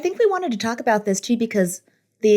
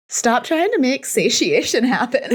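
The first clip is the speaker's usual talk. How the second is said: laughing; 6 semitones higher than usual. Real, not acted. Joking movie-quote riff.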